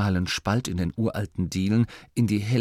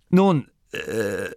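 hesitant. Acted. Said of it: "Masklin zögerte".

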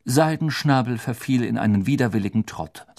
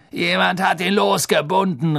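higher, louder grumbling; gruff snarl; 9 semitones higher, 5 dB louder than usual. Acted. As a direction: growl it out, annoyed. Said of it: "raunzte der Henker".

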